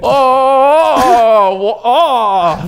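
high, loud dying cries; anguished cry. Acted. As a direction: cry out as if stabbed. King stabbed, crying out.

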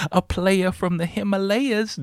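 high, drawn-out comic catchphrase; Martin Lawrence. Retold or imitated. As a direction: quote the sitcom line in a high, slow voice. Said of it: "The Martin reference".

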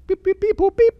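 high, loud sung dial tones; phone dial beeps. Retold or imitated. Coach imitating the phone keypad with high, loud beeps.

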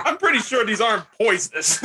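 quoting Tracy Morgan while laughing; laughing impression. Cracking up retelling the hornet ad, laughing voice. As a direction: giggle through the line. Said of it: "Tracy Morgan".

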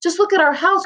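high-pitched exasperated outburst; narrated father's upset protest; dismayed cry. A narrator's reading. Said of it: "cried Mister Poor Bucket".

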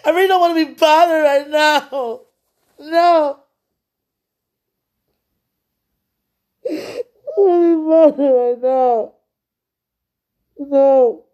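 high, loud crying yell; yelling in tears. Real, not acted. Upset woman yelling at God to leave her be. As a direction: push them away through sobs. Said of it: "Excuse me for yelling".

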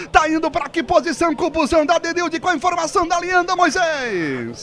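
high, sustained announcer delivery; excited sponsor read. Real, not acted. Commentator throwing to reporter Moisés with sponsor plugs, high excited announcer voice. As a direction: project it high, then hand over.

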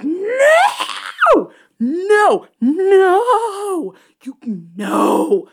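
high, loud, crying noes; anguished wail. Retold or imitated. Host crying out at the ending, high loud wail. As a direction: break into a laughing sob. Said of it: "I'm like".

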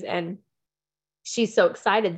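ordinary delivery

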